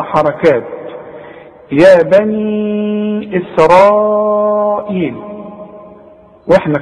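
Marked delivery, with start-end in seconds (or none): 1.7-5.2 slow drawn-out tajweed recitation; Quran recitation. Shown as a demonstration. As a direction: stretch the vowels as in recitation. Recites verse.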